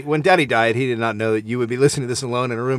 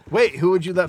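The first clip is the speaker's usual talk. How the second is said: usual speaking voice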